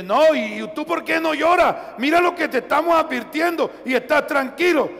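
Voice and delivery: high, loud reproach; mock the worried brothers shouting at him; incredulous protest at his calm; exasperated scolding. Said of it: "Y dice".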